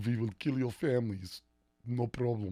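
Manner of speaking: mock Serbian mobster accent; Serbian mafia. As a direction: play an Eastern European thug making threats, accent and all. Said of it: "When the Serbian mafia comes in".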